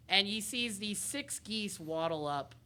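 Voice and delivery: normal talking voice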